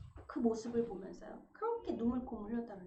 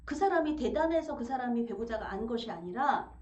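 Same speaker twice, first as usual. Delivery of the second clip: ordinary talk, nothing marked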